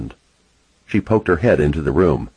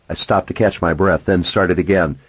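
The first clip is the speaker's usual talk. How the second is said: plain reading voice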